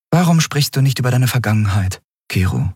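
Endriel, curious. Acted. Captain asking Kero about his past, probing. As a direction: gently dig at his past.

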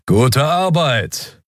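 high, very slow sly creature voice; skeleton's praise. Acted. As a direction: drawl the praise with a sneer.

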